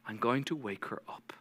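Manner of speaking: soft whispered promise. Retold or imitated. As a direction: breathe it out, barely voiced. Jesus quietly announces miracle.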